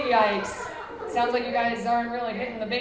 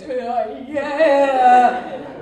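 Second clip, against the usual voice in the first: loud drawn-out sung yeahs; massage moaning; 2 semitones higher, 9 dB louder than usual. Retold or imitated. Comic imitating her Theragun noises, loud moaning yeahs. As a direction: moan with pleasure. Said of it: "I'm making insane noises".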